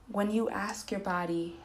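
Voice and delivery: normal talking voice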